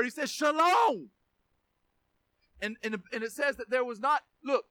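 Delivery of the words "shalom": high, shouted single-word command; drawn-out commanding cry; one-word rebuke. Acted. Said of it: "he did it with one word".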